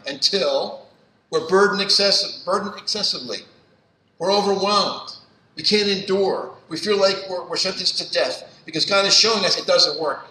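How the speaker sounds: loud, high, impassioned preaching; preaching at full force. Acted. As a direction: drive it home to the congregation. Preaches to the congregation.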